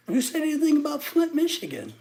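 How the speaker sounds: high, loud incredulous retort. Real, not acted. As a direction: shoot back in disbelief. Exasperated retort to chat.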